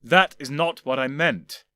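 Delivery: restrained anger, slow; through clenched teeth. Acted. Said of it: "said slowly through clenched teeth".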